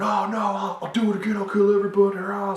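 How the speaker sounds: high-pitched, mock crazed outburst; hammed-up rant; going nuts. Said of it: "the Punisher just goes nuts".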